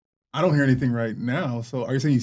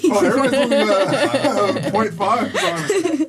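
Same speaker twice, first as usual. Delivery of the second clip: slow words broken up by real laughter, high and loud; laughing; 9 semitones higher, 7 dB louder, much slower than usual. Real, not acted. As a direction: laugh through it, amused that everyone picks the same. Laughing at everyone's same score.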